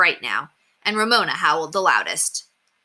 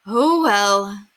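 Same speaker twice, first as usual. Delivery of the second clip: resigned, sighing; mother sighing at her wet kitchen; 3 semitones higher, 5 dB louder than usual. Acted. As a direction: let it go with a tired sigh. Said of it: "she said with a sigh".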